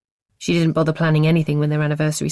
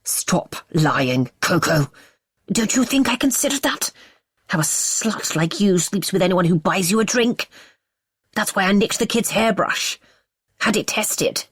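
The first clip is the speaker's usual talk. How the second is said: menacing rage; 3 semitones higher than usual. Acted. Husband choking her, raging.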